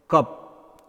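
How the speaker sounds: cup with a brief uh; short uh in "cup". Shown as a demonstration. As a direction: keep the vowel of cup short. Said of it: "this is pronounced A".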